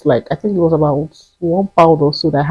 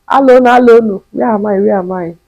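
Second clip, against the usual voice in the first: lost; 3 semitones higher, 4 dB louder than usual. Retold or imitated. Lost, asking strangers.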